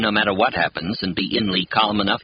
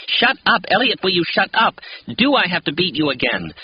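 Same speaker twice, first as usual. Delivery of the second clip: angry mother; 10 semitones higher than usual. A narrator's reading. Worn-out mother yells at her whining son to shut up. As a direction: lose patience and yell at the child.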